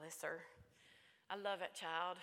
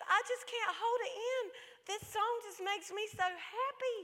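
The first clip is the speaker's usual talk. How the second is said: imitated child's high, overwhelmed wail; tearful happy child; 12 semitones higher, 4 dB louder than usual. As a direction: burst out in a high voice, overcome with joy. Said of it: "choked back her tears".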